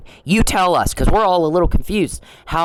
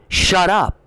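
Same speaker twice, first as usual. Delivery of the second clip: short, hard, final shut up; blunt command; 4 semitones higher than usual. Real, not acted. To the politician he's sick of, a curt order. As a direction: cut him off flat and firm.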